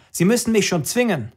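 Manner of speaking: put-on young man challenging the commander; defiant challenge. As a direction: dare him to use force.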